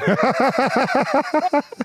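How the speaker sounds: high burst of laughter; laughing. Real, not acted. Prankster who just hung up on the caller, laughing. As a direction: crack up laughing.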